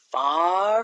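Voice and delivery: plain reading voice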